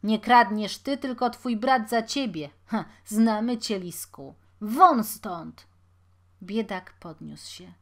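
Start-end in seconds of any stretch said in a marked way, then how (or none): 0.0-4.3 loud, scornful accusation; accusing. A narrator's reading. Watchman accusing the pauper, loud and angry. 4.6-5.7 loud order with falling end; shouted dismissal. A narrator's reading. Watchman chasing off the pauper, loud command. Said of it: "krzyknął jeszcze głośniej".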